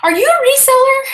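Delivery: high, loud, eager retold question; excited question. Asking the neighbor if he's a reseller, excited.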